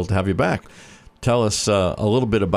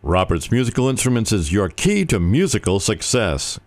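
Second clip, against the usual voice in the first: upbeat radio ad read; 4 semitones higher than usual. Acted. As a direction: sell it like a sponsor spot. Sponsor ad read.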